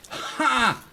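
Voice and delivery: high, loud "ha!"; gloating bark of laughter; short victorious laugh; triumphant laugh. Real, not acted. Triumph over enemy.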